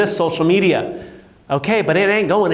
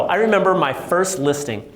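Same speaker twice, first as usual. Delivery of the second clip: ordinary delivery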